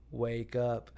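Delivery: mock eerie whisper-like. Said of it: "look up at him and go".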